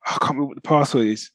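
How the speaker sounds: ordinary delivery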